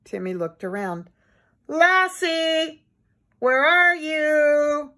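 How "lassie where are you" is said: high, long-held call for the dog. A narrator's reading. Timmy looks around for his dog, calling out. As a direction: call into the distance, stretching the words. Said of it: "Timmy called again desperately".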